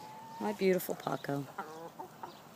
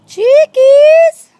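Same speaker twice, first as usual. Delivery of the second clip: calling out; 26 dB louder than usual. Real, not acted. Calling chickens from afar.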